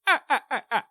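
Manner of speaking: high, lightly voiced frantic comic warning; a quick hint of a jumpy TV safety clown; Fire Marshal Bill. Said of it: "made me think of Fire Marshal Bill".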